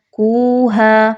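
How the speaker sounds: کوہا with a thick, heavy qaf, drawn out slowly. Shown as a demonstration. Teacher showing the correct heavy qaf, slowly. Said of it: "قاف کو باریک پڑھتے ہیں... موٹا کر کے".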